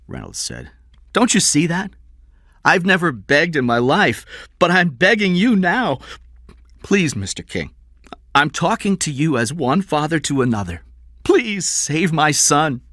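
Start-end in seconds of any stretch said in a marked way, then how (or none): 1.1-12.8 high, loud, urgent begging; desperate pleading father. A narrator's reading. Father begs his employer to save his son, desperate pleading. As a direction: beg with rising desperation, pitched high.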